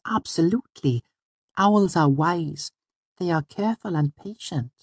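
put-on very high, deliberate girl character; high-pitched little sage; earnest Auri. A narrator's reading. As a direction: explain it solemnly in a high girlish voice. Auri's character voice explaining owls.

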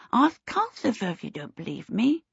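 put-on high, soft little-boy voice; lisping child. A narrator's reading. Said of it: "have to lisp like that".

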